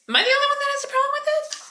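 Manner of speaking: exasperated bystander asking aloud, voice pitched high; incredulous high repeat. Shown as a demonstration.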